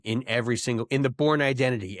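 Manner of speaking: normal talking voice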